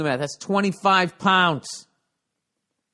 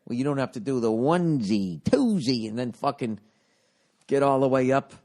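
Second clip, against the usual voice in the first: normal talking voice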